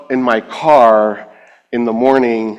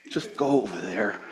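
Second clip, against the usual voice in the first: much softer, patient instruction; calm voice of God; 3 semitones lower, 13 dB quieter than usual. Retold or imitated. God telling the protesting believer to go, calm and soft. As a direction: hush it into a gentle order.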